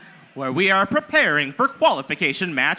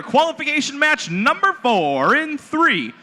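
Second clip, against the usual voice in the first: excited countdown; 7 semitones higher, slower than usual. Real, not acted. Countdown to match start.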